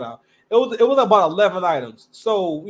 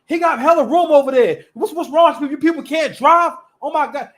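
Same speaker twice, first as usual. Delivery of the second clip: higher, louder mock of a rude driver complaining; 8 semitones higher, 6 dB louder than usual. Retelling the rude driver yelling about his parking, loud and high. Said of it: "I hear the person from the driver's seat go".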